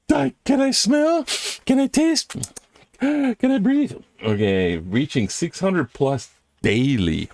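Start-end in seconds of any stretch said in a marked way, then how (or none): 0.0-3.9 high gasping fake panic; mock panic. Reacting to record covid cases with high, gasping mock panic. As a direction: gasp each question like you're suffocating.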